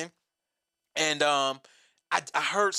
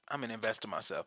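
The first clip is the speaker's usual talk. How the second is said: quiet; 7 semitones lower, 10 dB quieter, faster than usual. Retold or imitated. Quiet resolve to himself.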